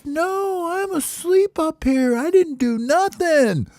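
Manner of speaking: very high, drawn-out falsetto protest; squeaky denial. Acted. Baby bird denies farting to his friends, squeaky and defensive. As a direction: protest innocence in a tiny squeal.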